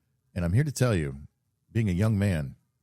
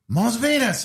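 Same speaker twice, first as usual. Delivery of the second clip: much higher, louder mock-French delight; French accent; 6 dB louder, slower than usual. French businessman naming his favourite strip club, hammed-up French accent. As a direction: sing out the name with a put-on French lilt. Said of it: "in a very thick, uh, French accent".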